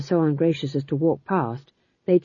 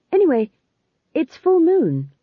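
high, louder, flustered interjection; flustered young witch cutting in; hurried change of subject; 10 semitones higher, 5 dB louder than usual. A narrator's reading. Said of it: "said Magrat quickly".

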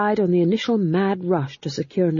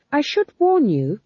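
put-on higher, young witch speaking up; a young witch trying to sound formidable; Magrat's voice; 9 semitones higher, 4 dB louder than usual. Magrat warning the guards, higher and earnest.

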